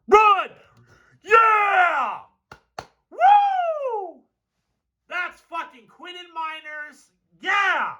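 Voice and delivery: high cheering shouts; root for the runner like a fan; excited cheering. Real, not acted. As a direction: fan yelling at the screen. Cheering a run on film.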